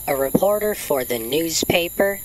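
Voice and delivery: normal talking voice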